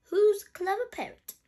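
high, loud pet-praising voice; high singsong question, falling at the end; Granny Pig praising. Acted. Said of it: "Granny Pig says".

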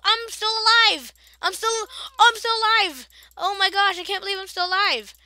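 high, loud disbelieving joy; excited. Real, not acted. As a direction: shout with relief. Survived an attack in game.

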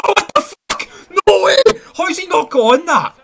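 high, loud cry of disbelief; can't believe what he just saw; stunned disbelief. Real, not acted. Gamer shouting in disbelief at a missed chance, high and loud.

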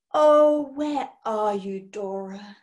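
slow, wistful call to a missing friend; sighing. A narrator's reading. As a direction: sigh it out, drooping to the end. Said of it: "sighed Hecky".

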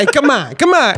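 laughing, high New York mobster whine; mock Italian-American protest, laughing through it. Imitating a mobster, laughing and high. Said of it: "une italienne New York".

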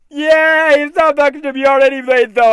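normal talking voice

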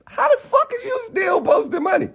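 angry shouted repeat; shouting in disbelief. Real, not acted. Ranting at a rival.

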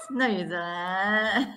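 ordinary talk

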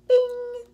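high, loud ringing bing; ping it out like a bell; bright sound effect, pitched high; imitated bing. A narrator's reading.

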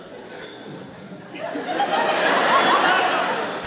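frantic high parrot screech; parrot squawk. Pretend. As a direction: squawk like a terrified parrot escaping. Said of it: "that was my impression of a parrot desperately trying to get away".

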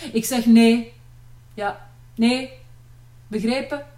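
loud, stern father's refusals in short bursts; barking. A narrator's reading. Father sends young men away, barking. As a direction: bark each refusal like an order. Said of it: "blafte vader al van ver".

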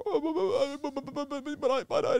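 high squeaky stammering panic, hammed up; mock terrified. Sex offender stammering at a threat, mock terrified. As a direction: stammer like a scared man cornered.

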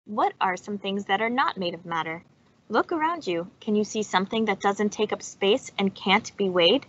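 normal talking voice

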